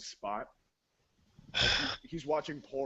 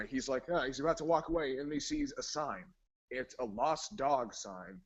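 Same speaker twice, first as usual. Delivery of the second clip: normal talking voice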